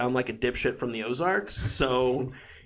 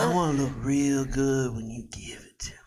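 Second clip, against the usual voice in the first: whispery Southern belle; Southern debutante. Acted. Debutante coming on to a date, whispery Southern accent. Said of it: "a debutante Southern female accent".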